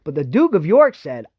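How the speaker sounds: ordinary delivery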